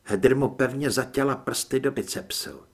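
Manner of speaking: usual speaking voice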